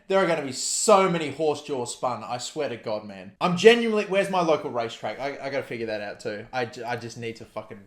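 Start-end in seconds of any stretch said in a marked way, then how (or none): none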